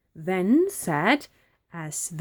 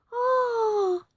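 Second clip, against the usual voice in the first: high-pitched drawn-out "Ah!"; witch's wail. A narrator's reading. Witch loses her hat in the wind, wailing. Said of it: "how the witch wailed".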